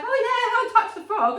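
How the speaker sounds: high, loud sarcastic chirp with a laugh; mock cheerful. Mum mocking the idea of touching frogs, high laughing sarcasm. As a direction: ham up the fake bravado.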